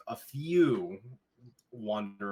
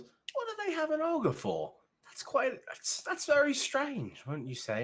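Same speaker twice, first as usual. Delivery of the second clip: much higher, prim gossiping voice; a fussy townsperson tut-tutting; prissy villager; slower than usual. Acted. Gossiping villager eyeing the ogre, prim and high. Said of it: "One of them is".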